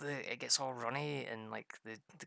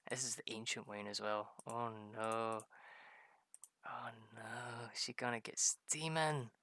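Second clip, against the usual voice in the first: usual speaking voice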